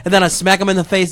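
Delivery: ordinary delivery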